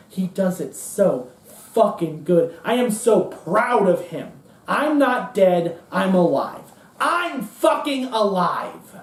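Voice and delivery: slow, drawn-out defiant pride, climbing in pitch; Cohn. Acted. Cohn insisting he lives on through his protégé, rising defiance. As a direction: build to an exultant, stretched-out declaration. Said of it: "And Cohn says".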